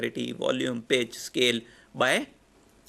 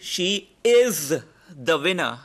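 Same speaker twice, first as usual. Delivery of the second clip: "is" hit much higher and louder in "She is the winner"; stress on is; 3 semitones higher, 6 dB louder, slower than usual. Shown as a demonstration. Teacher demos stress on "is" to the class. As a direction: punch "is" to mean she is the winner right now. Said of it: "in present tense".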